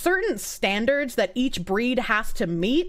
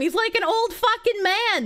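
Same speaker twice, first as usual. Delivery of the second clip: gushing squeal; 10 semitones higher than usual. Real, not acted. Gushing over bearded cat.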